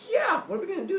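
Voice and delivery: high, mock-girlish excitement; eager girlfriend. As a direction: put on a high, eager female voice. Voicing the eager goddess.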